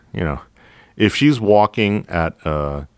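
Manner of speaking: usual speaking voice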